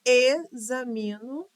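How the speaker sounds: "Examino" slowly with the first E set apart; syllable by syllable. Shown as a demonstration. Teacher showing where to separate, "Examino" syllable by syllable. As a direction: split "Examino" as e-xa-mi-no. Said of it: "Esse E também separa".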